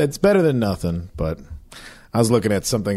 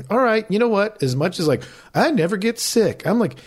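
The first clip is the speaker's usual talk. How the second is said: higher, emphatic mock reasoning with himself; mock self-lecture; 10 semitones higher than usual. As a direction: talk yourself into the flu shot, half joking.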